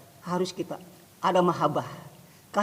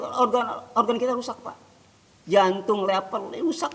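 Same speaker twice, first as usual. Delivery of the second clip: usual speaking voice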